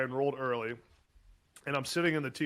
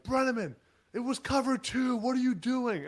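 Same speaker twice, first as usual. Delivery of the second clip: retold coach yelling; 10 semitones higher than usual. Coach chewing out player.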